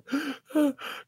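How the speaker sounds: high, sharp sobbing gasp, imitated; mock sob-gasp; imitated crying. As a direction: mimic catching your breath while crying.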